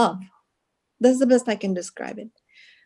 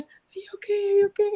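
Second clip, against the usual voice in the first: worried friend; 12 semitones higher, faster than usual. Retold or imitated. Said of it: "she said".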